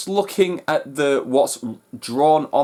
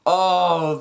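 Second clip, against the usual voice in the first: slow, drawn-out and louder; awed oh my god; 4 dB louder, much slower than usual. Real, not acted. After the trailer ends, slow awed oh my god. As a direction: let it sink in, stretched out.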